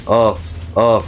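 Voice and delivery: normal talking voice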